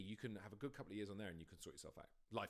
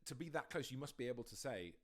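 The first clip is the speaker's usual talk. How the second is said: ordinary delivery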